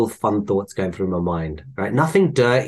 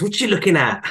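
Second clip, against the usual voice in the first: higher challenging snap, lightly voiced; confrontational lady; 8 semitones higher, faster than usual. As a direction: challenge a stranger. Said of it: "looks at the guy behind me and goes".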